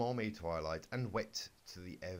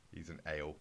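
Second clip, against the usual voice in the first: ordinary delivery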